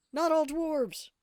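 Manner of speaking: high, loud, slow Not all dwarves protest; hammed-up offended cry; mock indignant. Dwarf protests miner stereotype.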